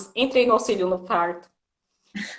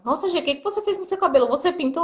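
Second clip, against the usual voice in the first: quick, higher gushing question; curious coworkers; 7 semitones higher, faster than usual. Retold or imitated. Coworkers ask Gi about her hair, fast higher mock curiosity. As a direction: rattle it off like nosy coworkers.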